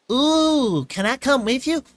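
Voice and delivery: high, loud, eager ooh; excited. A narrator's reading. Filly begging Twilight to come along, excited. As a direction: bounce with excitement as you ask. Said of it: "asked excitedly".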